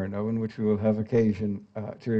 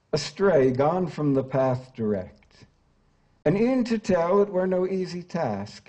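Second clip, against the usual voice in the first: higher, sweeping dramatic verse reading; poem recitation; 5 semitones higher than usual. Acted. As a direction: declaim the opening of the Inferno. Reads Carey's Inferno lines.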